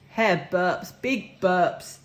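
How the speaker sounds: ordinary delivery, nothing marked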